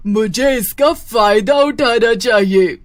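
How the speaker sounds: loud, slow witch voice; scheming witch. Acted. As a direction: draw it out slyly like a plotting witch.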